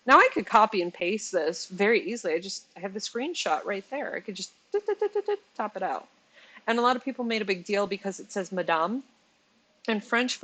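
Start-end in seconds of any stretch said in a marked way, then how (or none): 4.9-5.4 clicking noises. Real, not acted. Mimicking copy-paste clicks.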